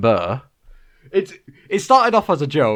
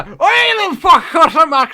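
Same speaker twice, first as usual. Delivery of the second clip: much higher mock Irish pirate, lively; Irish accent; 12 semitones higher than usual. Joking about pirate accents, a high hammed-up Irish voice. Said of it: "that's Irish".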